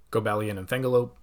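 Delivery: normal talking voice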